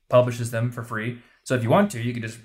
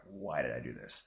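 quiet regret; 12 semitones lower, 14 dB quieter than usual. Pretend. Regretful aside to himself.

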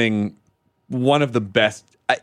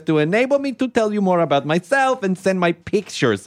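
lightly put-on Eastern European accent, higher; mock foreign accent; 5 semitones higher than usual. Podcast host reads a spam love letter in mock Russian accent. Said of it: "I'll read it in the accent I assume it was written in".

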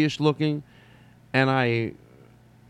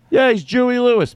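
high, loud confident fan; insistent fan; 12 semitones higher, 9 dB louder than usual. Pretend. Fan proudly naming the wrong comedian, high and loud. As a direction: blurt the wrong name proudly.

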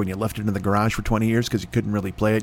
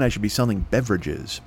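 ordinary talk, nothing marked

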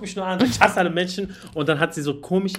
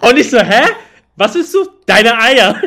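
much louder, higher, laughing; loud laughing disbelief; 7 semitones higher, 15 dB louder than usual. Retold or imitated. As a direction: yell it out half laughing. Retold shocked reaction, laughing. (laut und lachend ungläubig)

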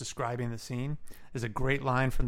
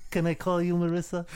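higher, put-on presenter voice; Jack Palance; 5 semitones higher, 3 dB louder than usual. Award presenter asking the nominee's approval, in a mock impression. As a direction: put on a raised, hammed-up voice to oblige her. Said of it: "read it in this voice".

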